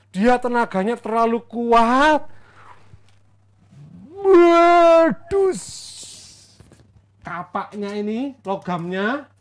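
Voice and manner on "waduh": long, high, loud cry of alarm; dramatic exclamation. Acted. Storyteller acts the axe head flying off, loud high cry. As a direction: stretch it out as something goes flying.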